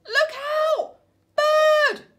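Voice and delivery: loud high shriek of alarm; panicked warning. Acted. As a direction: scream the warning. Snails warn of bird.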